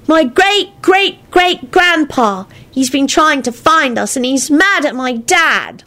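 put-on slow, high-pitched little boy; upset child. A narrator's reading. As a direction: explain it slowly in a child's voice.

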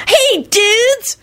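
high, drawn-out dude greeting; gleeful high-pitched stoner kid; delighted yelp. A narrator's reading. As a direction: yelp happily at your friends. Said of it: "Kenny yelped delightedly".

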